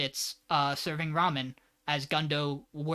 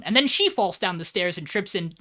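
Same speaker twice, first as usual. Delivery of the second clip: ordinary talk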